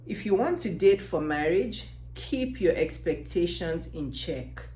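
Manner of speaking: usual speaking voice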